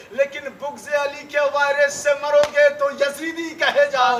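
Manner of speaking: loud, high, fiery majlis oratory; rousing sermon delivered loud and high to the mourners; preaching at full force. Acted. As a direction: hammer each line at the crowd like a pulpit orator.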